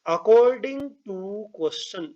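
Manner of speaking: drawn out word by word; slow dictation. Real, not acted. Teacher writing the question out for students, slow dictation. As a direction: stretch each word while writing it out. Reads out while writing on board.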